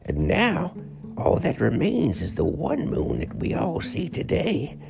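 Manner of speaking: a tired ancient sage; old man voice. Acted. Old man tells legend.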